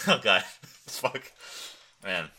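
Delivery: ordinary talk, nothing marked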